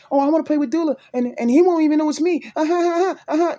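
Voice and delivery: high, gloating mock voice; mocking imitation. Mocking someone who pretends to be Miss Reed, high gloating imitation. Mimics someone scheming to fool him.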